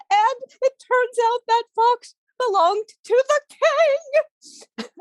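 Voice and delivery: high, slow teary voice; crying daughter's plea; weeping daughter. A narrator's reading. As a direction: whimper like a crying girl. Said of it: "says one of the daughters".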